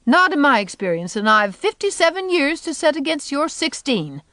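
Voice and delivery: high, emphatic put-down; crotchety, dismissive comeback; retorting. A narrator's reading. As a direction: pull rank on a youngster. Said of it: "retorted Eliza".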